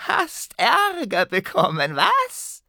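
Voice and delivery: high, slow goblin taunt; gloating goblin. Acted. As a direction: tease like a cheeky goblin.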